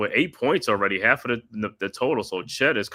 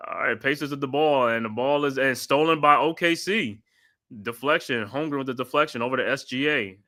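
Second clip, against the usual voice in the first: steady broadcast play-by-play, calling each pass; 4 semitones higher than usual. Acted. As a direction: call the game like a TV announcer. Live play-by-play call.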